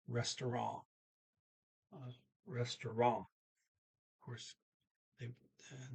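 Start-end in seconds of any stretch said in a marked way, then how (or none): 0.1-3.2 "restaurant" with a French-like nasal ending; a little French in "restaurant", silent T, stressed last syllable; slight French accent. Shown as a demonstration. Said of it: "Kinda like a little French, uh, accent".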